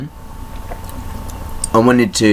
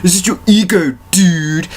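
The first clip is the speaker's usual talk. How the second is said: higher, sneering dude-bro mockery; higher sneering imitation of dismissive people; dismissive mocking voice; 6 semitones higher than usual. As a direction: parrot the dismissive advice with scorn. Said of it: "And it's all like".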